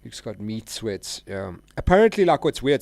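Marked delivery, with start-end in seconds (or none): 1.8-2.8 loud, high opening word; loud high-pitched apparently; excited burst. Real, not acted. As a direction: launch in excited. Excited start of a story.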